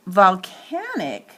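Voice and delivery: volcanic with CAN punched; stress on CAN. Shown as a demonstration. Teacher showing the accented syllable, CAN stressed. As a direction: hit the second syllable of volcanic. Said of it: "the second syllable is clearly accented".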